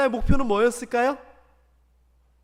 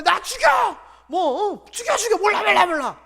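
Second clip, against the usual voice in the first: loud, higher, petulant outburst; defiant shouting; 9 semitones higher, 6 dB louder than usual. Retold or imitated. Jonah yelling defiantly at God, loud and high. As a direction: yell it like a sulking rebel. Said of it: "요나가 이랬는데".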